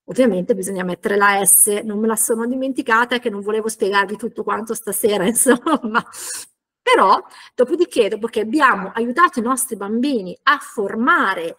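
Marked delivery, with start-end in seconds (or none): none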